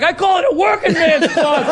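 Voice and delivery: high, laughing mock retort; mock indignant guy. Mocking a drunk's retort at the meeting, high and laughing. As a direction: toss it out like a smug complainer.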